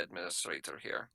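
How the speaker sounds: normal talking voice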